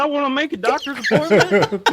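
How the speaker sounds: put-on high, loud Southern accent. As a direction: play up a Southern twang on the phone. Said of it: "with the Southern accent".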